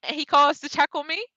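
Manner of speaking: ordinary delivery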